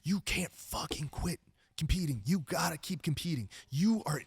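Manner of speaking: insistent, well-meant telling-off; friendly scolding. Retold or imitated. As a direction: lay into them like a friend urging them on. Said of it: "Mike lays into me, like, in a friendly way".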